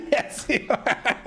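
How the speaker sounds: said through high laughter; slow words broken by laughs; laughing. Real, not acted. Laughing reply to robot voice.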